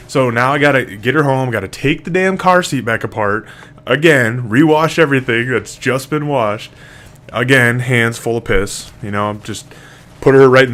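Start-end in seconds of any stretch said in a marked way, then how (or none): none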